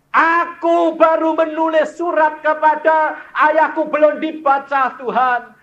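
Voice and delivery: high, loud fervent prayer of a son; son's tearful prayer, preached. Retold or imitated. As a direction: cry out the son's thanks to God. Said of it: "dia menangis karena kasih Allahnya".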